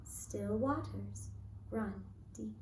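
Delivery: usual speaking voice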